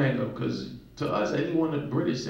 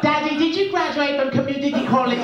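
put-on high, singsong British child voice; British little girls; 8 semitones higher, 3 dB louder than usual. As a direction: tease Daddy like a smug little girl. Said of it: "those same irritating accents".

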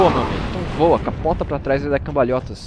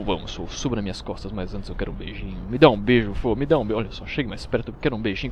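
lower, softer, teasing dragon; 5 semitones lower, 4 dB quieter than usual. Pretend. Dragon teasing his rider for a kiss in a lower, softer voice. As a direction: coax him closer like a flirty dragon.

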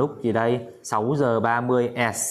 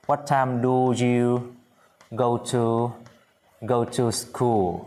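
slow model question; much slower than usual. Shown as a demonstration. Teacher models question slowly.

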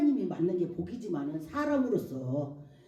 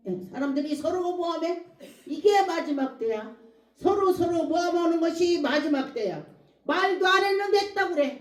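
loud, high sermon proclamation; forceful preacher's delivery; preaching; 9 semitones higher, 7 dB louder than usual. Real, not acted. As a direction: hammer the scripture home.

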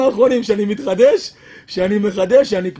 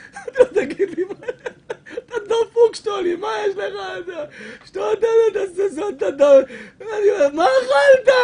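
high, laughing, half-crying disbelief; a retold laughing shout, higher pitched; laughing outburst; 7 semitones higher than usual. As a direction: crack up while yelling at him. Rabbi's laughing outburst at dealer.

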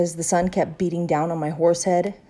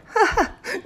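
high, short burst of laughter; real loud laugh. A narrator's reading. Teacher reacts to the girl's mistake, laughing. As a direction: laugh out in amusement. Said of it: "Missus laughed real loud".

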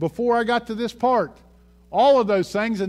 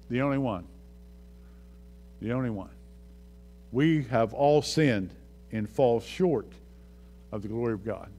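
barely above a murmur, much lower and softer; hushed; 11 semitones lower, 8 dB quieter than usual. Real, not acted. Preacher to congregation about Jesus, in a hushed voice. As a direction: drop to a reverent hush.

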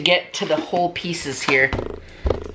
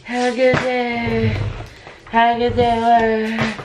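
slow drowsy mumble, half asleep; sleepy voice; 4 semitones higher, slower than usual. Retold or imitated. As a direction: say it like you're talking in your sleep. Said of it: "you were like".

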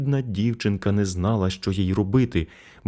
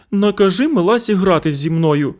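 put-on high, loud nagging child; boy repeating his demand to mother; 12 semitones higher, 6 dB louder than usual. A narrator's reading. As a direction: insist again like a toddler. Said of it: "повторював він".

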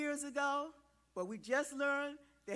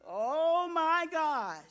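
slow, loud and high amazement; drawn-out delighted wonder; oh my gosh amazed; 6 semitones higher, 11 dB louder, much slower than usual. Real, not acted. Preacher marvelling at the adults pointing at themselves, amazed.

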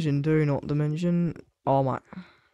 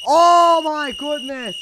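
high, slow stretched exclamation; excited shout; 11 semitones higher, 8 dB louder than usual. Real, not acted. Gamer reacts to the play with a drawn-out high shout. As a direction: let it ring out in shock.

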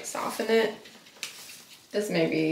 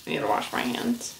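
ordinary delivery

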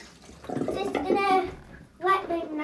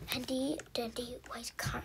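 whisper; 4 semitones lower, 13 dB quieter than usual. Real, not acted. Whispering to camera.

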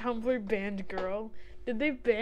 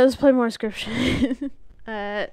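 ordinary talk, nothing marked

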